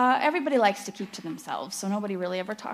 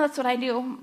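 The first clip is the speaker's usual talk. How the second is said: usual speaking voice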